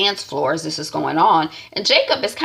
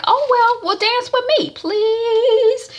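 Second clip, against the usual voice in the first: much higher, sing-song begging; coy pleading; slower than usual. Retold or imitated. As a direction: lay the charm on thick. Rochelle begging bishop to dance.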